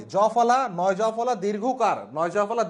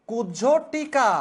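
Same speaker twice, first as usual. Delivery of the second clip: slow careful model word; 2 semitones higher, much slower than usual. Shown as a demonstration. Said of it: "এটা হচ্ছে সঠিক বানান".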